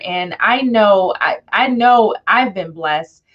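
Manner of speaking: usual speaking voice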